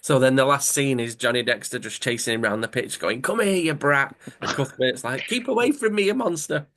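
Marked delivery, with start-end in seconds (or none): 3.2-4.1 higher-pitched chasing yell; mock angry shout. Acted angry chase round pitch. 5.3-6.7 much higher mock yelp; high-pitched imitation of a chased boy; fleeing nephew. Acted nephew fleeing his uncle.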